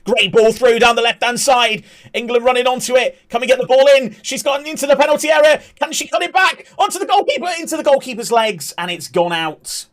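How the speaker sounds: rising thrill of an attack on goal; excited commentary. Acted. Commentator getting carried away as England near goal, excited shout. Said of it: "Jim is in top commentator mode".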